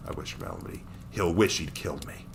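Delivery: usual speaking voice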